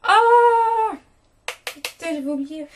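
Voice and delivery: sudden loud gasp of realization; startled, high cry; surprised exclamation. Real, not acted.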